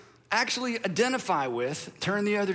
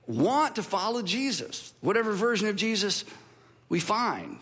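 ordinary delivery

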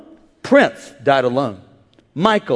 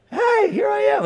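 much higher, bright greeting; high cheerful Jesus. Pretend. Lightly voiced Jesus welcoming believers, high. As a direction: play a jolly host.

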